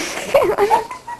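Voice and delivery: very high breathless squeal of laughter. Real, not acted. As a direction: let out a tiny helpless squeal. Laughing fit mid-question.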